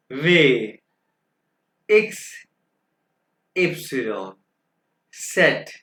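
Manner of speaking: each letter called out singly, with long pauses; German alphabet demonstration. Shown as a demonstration. Teacher reads alphabet for students to follow, emphatic spaced-out letters. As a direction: model each letter name firmly, drawn out, one at a time. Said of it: "मैं आपके सामने दो दफा alphabets पढ़ूंगा".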